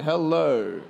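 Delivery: ordinary delivery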